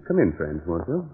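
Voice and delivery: soft, sinister invitation; eerie hush. Acted. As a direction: lure them in quietly. Host invites listener in eerily.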